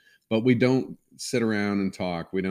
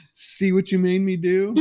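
put-on high, louder little kid blaming; accusing child voice; 12 semitones higher, 8 dB louder than usual. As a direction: play the small boy pointing the finger. Child blaming, retold in higher voice.

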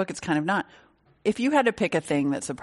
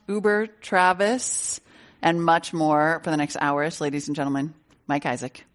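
normal talking voice

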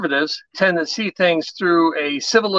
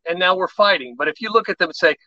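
ordinary talk

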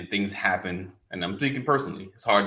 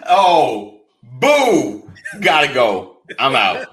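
loud, higher playful boo and bail-out; mock exit shout; 5 semitones higher, 13 dB louder, much slower than usual. Cohost groaning at the pun, loudly fake-leaving the studio. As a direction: boo the joke loudly and storm off in jest. Mock walking out after bad joke.

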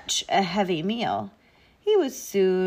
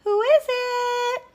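put-on very high, slow old-lady call; Granny calling out; 5 dB louder, slower than usual. A narrator's reading. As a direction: call to the door like a storybook granny. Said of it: "called out Granny".

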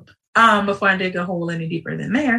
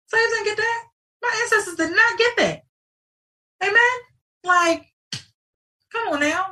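way higher, calling out to the crowd; high excited outcry; 13 semitones higher than usual. Real, not acted. Podcaster riling up listeners, high impassioned call.

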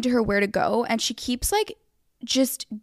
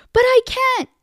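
high, loud mock wail; pitched-up put-on protest; helpless driver; 13 semitones higher, 8 dB louder than usual. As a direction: imitate a flustered driver giving up.

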